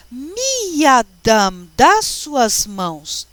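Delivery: loud, slow and stretched, word by word; careful Hebrew reading. Shown as a demonstration. Teacher reads Hebrew and translates for students, slow and emphatic. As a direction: project it slowly, drawing out each syllable.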